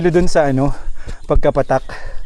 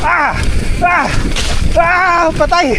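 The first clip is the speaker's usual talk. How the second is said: screaming while falling; 10 semitones higher, much slower than usual. Real, not acted. Losing speed on rough track.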